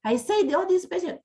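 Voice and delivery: imitated angry shout. Impatient clinician scolding patients on the ward, shouting. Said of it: "you are shouting to patients".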